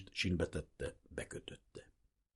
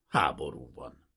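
"Háború van" lower and falling, like closing the matter; resigned apology; 5 semitones lower than usual. A narrator's reading. Sergeant excuses the search to the prince, falling and resigned. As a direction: let "Háború van" sink into a lower, falling, regretful close. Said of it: "szinte bocsánatkérően".

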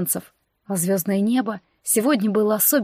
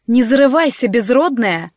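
louder, venomous warning; spiteful hiss; 3 semitones higher, 6 dB louder than usual. Acted. Necromancer sister spitefully warning the heroine, louder. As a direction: spit it out with contempt. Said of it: "озлобленно прошипела она".

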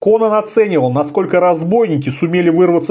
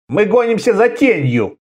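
mild griping, pitched up; 6 semitones higher than usual. A narrator's reading. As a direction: complain peevishly in a raised pitch. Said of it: "брюзжал Талбор". (брюзгливо, повышенным тоном)

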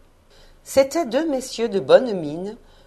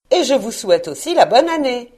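louder, higher parting greeting; cheerful wish; 4 semitones higher, 6 dB louder than usual. A narrator's reading. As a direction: toss in one more hearty wish. Neveu ajoute un vœu. (vœu enjoué et sonore)

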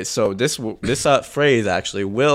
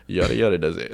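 weary sigh; 7 semitones lower, 4 dB quieter than usual. Retold or imitated. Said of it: "he always says".

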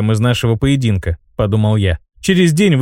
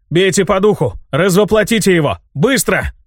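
high, urgent battle command; urgent shout; 6 semitones higher than usual. A narrator's reading. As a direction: yell the order fast. Urgent command during the fight.

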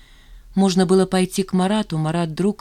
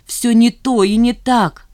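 slow, loud, higher-pitched lament; anguished protest; 5 semitones higher, 8 dB louder, slower than usual. A narrator's reading. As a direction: drag out the protest, voice raised. Woman anguished by lover's confession. (с надрывом, громко и протяжно)

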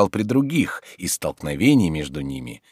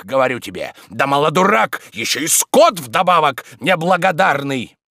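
loud, high-pitched indignant scolding; angry tirade; 10 semitones higher, 6 dB louder than usual. Acted. Tarantyev rebukes Oblomov as ungrateful, angry tirade. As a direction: heap reproach on him, building in heat.

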